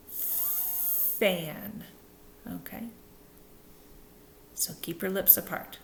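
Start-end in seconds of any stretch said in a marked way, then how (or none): 0.1-1.9 fan with teeth on lip and a long blown F; correct F. Shown as a demonstration. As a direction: stretch the F in fan with air. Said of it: "We want".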